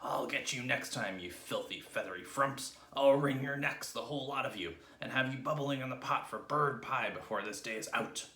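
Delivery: mock-screamed threat at the birds; angry screaming. A narrator's reading. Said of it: "Mister Twit screamed at the birds".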